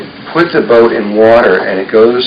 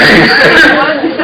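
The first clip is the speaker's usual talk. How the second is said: real high-pitched laugh; bright burst of laughing at himself; high laughter. Real, not acted. Laughs about toy day.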